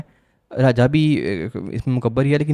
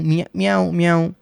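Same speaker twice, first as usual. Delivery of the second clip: म्याऊं म्याऊं said as a light cat meow, a little higher; cat meow imitation; 4 semitones higher than usual. Shown as a demonstration. Teacher imitates a cat's meow for students, lightly meowing. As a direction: mew it like a cat for the class. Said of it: "कौन सी आवाज़ें हो सकती हैं?".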